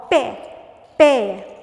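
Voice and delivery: "pé" called out loud and alone; isolated syllable. Shown as a demonstration. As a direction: punch out pé as one separate syllable. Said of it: "primeira sílaba". (pé, sílaba isolada e forte)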